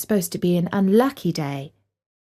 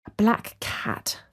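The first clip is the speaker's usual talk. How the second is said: whispered, slow dread; slow whispered realisation; thought aloud; 5 dB quieter, much slower than usual. A narrator's reading. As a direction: breathe it as a fearful whisper. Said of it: "thought Mum".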